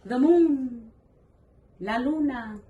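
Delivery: put-on high delighted naming for a child; excited reading to a toddler. Shown as a demonstration. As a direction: point and exclaim for a toddler. Showing child what to say.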